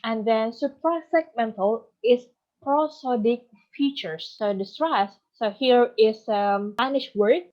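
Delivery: ordinary talk, nothing marked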